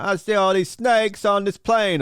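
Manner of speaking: lightly imitated Samuel L. Jackson; a loose nod to the movie line. Retelling the film to viewers, lightly imitated. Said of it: "he was like".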